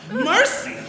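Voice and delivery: terrified cry. Acted. Scrooge terrified by the jaw dropping.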